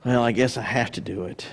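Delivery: low, resigned mock giver; put-on sense of weary obligation; grudging attitude. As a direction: give in reluctantly, pitch dropped right down. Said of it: "Notice that the attitude".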